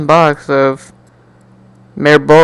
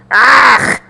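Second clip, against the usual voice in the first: exasperated ah; 9 semitones higher than usual. Acted. Exasperated cry.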